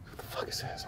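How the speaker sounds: retold in a bare whisper; whispered alarm. Student hearing sirens at school, whispered alarm. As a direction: whisper it as a startled private thought.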